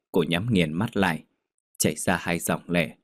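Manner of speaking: ordinary delivery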